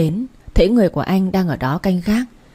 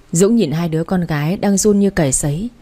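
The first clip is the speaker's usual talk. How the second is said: usual speaking voice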